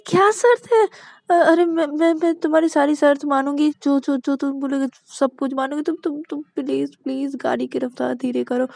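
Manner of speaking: desperate pleading. Acted. Terrified passenger begs.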